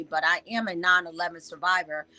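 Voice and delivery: ordinary talk, nothing marked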